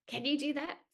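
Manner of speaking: usual speaking voice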